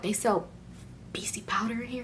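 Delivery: near-whispered, secretive asking; hushed mock astonishment; hushed question. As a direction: drop to a whisper, discreetly. Covert astonished question in the store.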